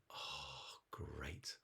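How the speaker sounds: low, hushed sigh of relief; relieved little snake. Acted. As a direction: let it out softly and low, acting relieved. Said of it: "Had a little laugh".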